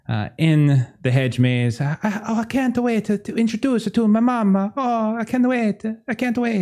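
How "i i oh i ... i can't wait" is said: high, eager bad Italian voice; high giddy Italian accent. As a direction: gush like an excited Italian host. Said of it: "there is Steve Areno".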